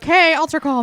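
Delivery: loud, high mock altar call. Friend mimics a pastor calling to the altar, loud. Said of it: "just like when they do, like, an altar call".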